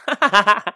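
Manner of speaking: short, loud laugh; sudden loud laugh; burst of laughter. Real, not acted. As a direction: crack up at the joke. Laughing at Schalke's bad season.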